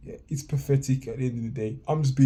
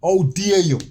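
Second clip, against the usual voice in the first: indignant; 6 semitones higher, 6 dB louder, slower than usual. Real, not acted. Indignant outburst at the host.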